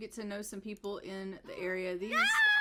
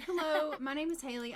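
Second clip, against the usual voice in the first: put-on lightly raised, cheery young woman's hello; a hint of a perky Sim voice; Haley's greeting; 7 semitones higher, 3 dB louder than usual. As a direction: introduce yourself brightly as a newcomer. Voicing her Sim Haley meeting someone.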